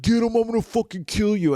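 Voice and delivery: high, loud imitation of a raging bully; mock furious threat. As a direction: yell it like a bully giving chase. Retelling the bully's threat while chasing.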